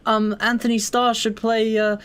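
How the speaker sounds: high-pitched imitation of eager fans; mock fan-caster. Mocking comic fans who instantly cast Marvel roles, high put-on voice.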